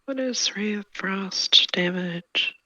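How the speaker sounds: slow breathy whisper. Real, not acted. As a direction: whisper the question slowly. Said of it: "whispered question to GM".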